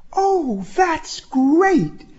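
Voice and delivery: very high-pitched, grateful woman's voice; thrilled, way up in pitch; delighted relief. Acted. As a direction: accept the offer with high, gushing pleasure. Woman delighted at offered ride.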